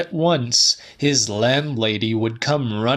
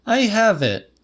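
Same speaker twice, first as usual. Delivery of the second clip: raised, higher woman's voice; Celia's voice; 10 semitones higher than usual. A narrator's reading. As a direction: lift the pitch up for Celia. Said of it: "she said".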